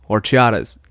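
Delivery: low-pitched one-word repeat; deadpan punchline. Retold or imitated. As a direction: drop the voice and say it straight.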